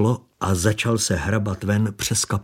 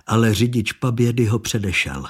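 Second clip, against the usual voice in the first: plain reading voice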